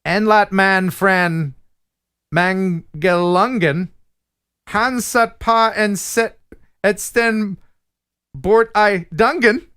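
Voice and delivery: slow, halting Swedish in an American mouth; attempted Swedish reading. Shown as a demonstration. Host reads a viewer's Swedish limerick, slow attempted Swedish. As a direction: sound out the Swedish word by word. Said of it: "actually read it in Swedish".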